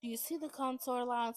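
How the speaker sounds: usual speaking voice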